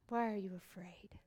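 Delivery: whispered, soft reassurance; calm them like a frightened child; gentle whisper. Acted. Jesus whispering to his frightened disciples, gently.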